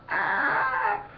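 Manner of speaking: high, soft mock cry of pain; a thin squeal for a burning stomach; mock stomach scream. Vlogger voicing his aching stomach after Ab Ripper, high mock scream. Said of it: "My stomach was, like, screaming at me".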